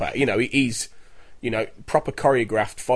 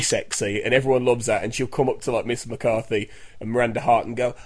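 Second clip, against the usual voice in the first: ordinary delivery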